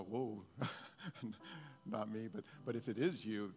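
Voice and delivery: ordinary talk, nothing marked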